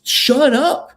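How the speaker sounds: high-pitched, worked-up telling-off; mock outraged rant; exasperated mock scolding. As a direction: scold an absent fighter in exasperation.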